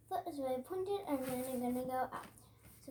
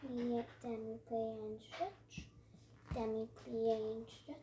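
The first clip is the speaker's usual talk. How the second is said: slow stretched ballet cue; slow, drawn-out cue; 3 semitones lower, 6 dB quieter, slower than usual. Shown as a demonstration. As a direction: draw out each move. Ballet teacher cueing moves.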